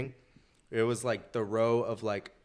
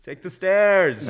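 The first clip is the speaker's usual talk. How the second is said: drawn-out, higher mock yell; crowd calling out; 7 semitones higher, 6 dB louder, slower than usual. Whole section telling the drunk kid off, drawn-out mock shout. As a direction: imitate a fed-up crowd shouting someone away. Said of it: "everybody's like".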